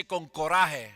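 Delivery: con coraje in Spanish pronunciation, loud; Spanish phrase. Real, not acted. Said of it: "In Spanish, we say".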